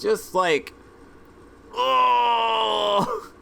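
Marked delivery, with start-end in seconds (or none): 1.7-3.3 a sudden high pained "oh"; startled cry. Real, not acted. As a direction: caught off guard, the word breaks out as a cry. Shocked by breakup text.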